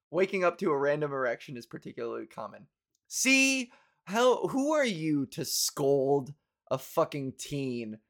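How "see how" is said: usual speaking voice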